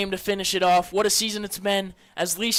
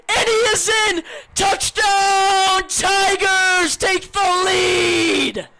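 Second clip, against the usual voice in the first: screaming high touchdown call, slowing at the end; 9 semitones higher, 5 dB louder, much slower than usual. Real, not acted. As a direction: belt it out, then stretch the last words. Announcer calls the touchdown.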